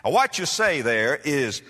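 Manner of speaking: usual speaking voice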